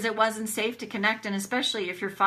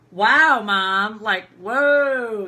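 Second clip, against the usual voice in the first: astonished reaction; 9 dB louder, much slower than usual. Retold or imitated. Daughter retells reacting to mom's wedding remark, loudly astonished. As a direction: re-enact being floored, drawing out each exclamation.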